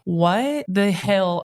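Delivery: slow, stretched what the hell; drawn-out mock disbelief. Host recalling hearing the story, slow mock disbelief. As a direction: let the disbelief hang on each word. Host retelling her shocked reaction.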